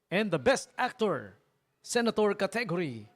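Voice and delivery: high, slow, drawn-out award presenter voice; mock awards announcer. DJ teasing senators with a fake awards show, hammed-up announcer. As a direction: announce the winner like a gala host.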